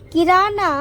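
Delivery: ordinary talk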